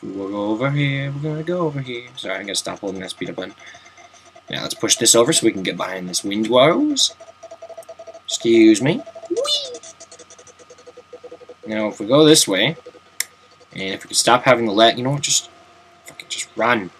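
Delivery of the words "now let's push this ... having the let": ordinary talk, nothing marked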